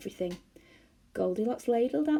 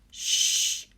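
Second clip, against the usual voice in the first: an irritated whispered shush; cross shush; 6 dB louder than usual. A narrator's reading. Moonface crossly hushing the bears. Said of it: "said Moonface crossly".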